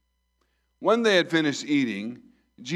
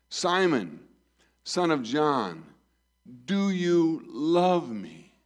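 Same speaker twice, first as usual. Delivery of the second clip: slow pleading question; 4 semitones higher, much slower than usual. Acted. Jesus voiced asking again.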